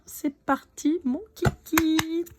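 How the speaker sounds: taquin. Acted. Taquine ses abonnés scorpions.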